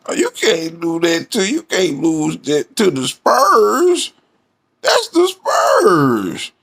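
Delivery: high, slow mock wail stressing Spurs; mocking Shannon. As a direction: whine it like a sore loser, leaning on Spurs. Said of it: "put the emphasis on, on when you saying our name".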